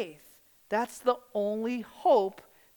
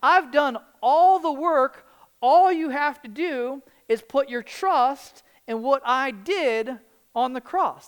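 louder, slow, weighty pronouncement; proclaiming Christ's words; 6 semitones higher, 7 dB louder, slower than usual. Retold or imitated. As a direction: declare it slowly like Christ speaking from the cross. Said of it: "He's saying".

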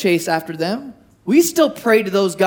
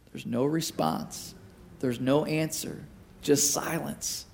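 hushed; 7 semitones lower, 12 dB quieter than usual. Real, not acted. Stressing God's silence.

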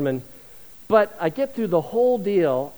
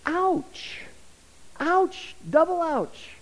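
much higher, stung ouch; mock wince; 10 semitones higher, 4 dB quieter, much slower than usual. Preacher reacting to Paul's rebuke, mock wince of pain. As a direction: flinch as if the verse hit you.

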